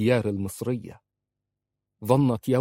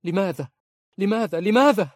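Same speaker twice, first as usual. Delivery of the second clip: high, louder screams building; hysterical; 11 semitones higher, 6 dB louder than usual. Acted. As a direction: scream the question, more frantic each time. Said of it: "قالت في هستيرية". (صراخ هستيري متصاعد)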